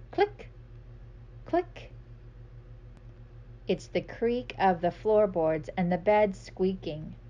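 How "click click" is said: high clicking sound. Acted.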